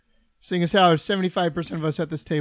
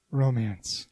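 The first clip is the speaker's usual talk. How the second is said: sexy voice; 7 semitones lower, 6 dB quieter than usual. Pretend. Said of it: "we're doing our sexy voices".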